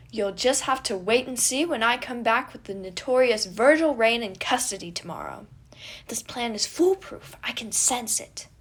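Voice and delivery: loud, sure boast; confident prince. A narrator's reading. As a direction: announce the plan proudly.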